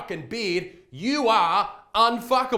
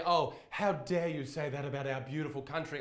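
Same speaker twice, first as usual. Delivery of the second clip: put-on quiet, prissy English accent; a hint of a pompous English voice; mock English accent; 4 semitones lower, 7 dB quieter than usual. Vlogger imitates an offended English person in a mock English accent. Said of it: "in your England you might say".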